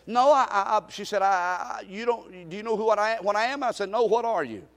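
ordinary delivery

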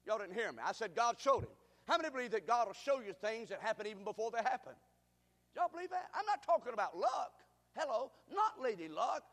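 usual speaking voice

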